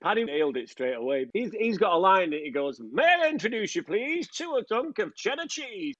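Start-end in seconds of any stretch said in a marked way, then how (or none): 2.8-5.9 posh voice. Retold or imitated. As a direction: put on a hammed-up upper-class accent. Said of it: "he did it in a posh voice".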